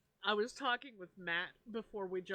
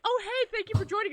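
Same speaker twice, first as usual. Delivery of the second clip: mock cheery greeting; 10 semitones higher, 7 dB louder, faster than usual. Mock host greeting joiners.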